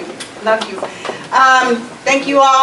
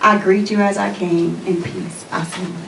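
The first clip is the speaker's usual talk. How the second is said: lower-pitched, measured closing address; formal exhortation; 5 semitones lower, 3 dB quieter than usual. Real, not acted. Speaker sends the congregation off in peace, formal exhortation. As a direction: deliver a solemn blessing in even, rolling phrases.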